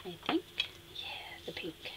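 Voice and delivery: whispered aside to herself; whispering. Real, not acted. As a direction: whisper the pick to yourself. Aside while choosing colour.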